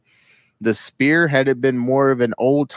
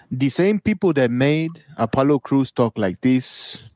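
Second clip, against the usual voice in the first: ordinary delivery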